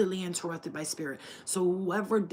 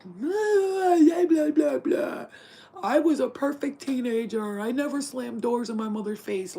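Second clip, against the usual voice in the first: shrill, loud mocking whine, much higher; 6 semitones higher, 8 dB louder, slower than usual. Parent scolding a joking teenager, mocked in a loud whine. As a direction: sneer it like a nagging parent. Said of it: "And you're like".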